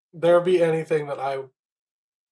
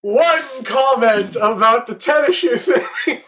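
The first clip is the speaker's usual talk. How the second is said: laughing; 5 semitones higher, 3 dB louder, slower than usual. Real, not acted. Laughing at the tennis shoe joke.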